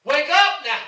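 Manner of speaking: loud preacher's wake-up call; rousing shout. Acted. Preacher telling Zion to wake up, rousing shout. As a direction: shout to rouse a sleeping people. Preacher calls congregation to awake.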